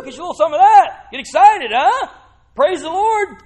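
high, loud rousing calls; excited urging. Real, not acted. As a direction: fire up the congregation. Urging congregation to get excited.

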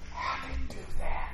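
hushed, voiceless whisper; amazed whisper. Acted. Preacher voicing onlookers wondering about the miracle, whispered. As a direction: whisper it as a stunned bystander would.

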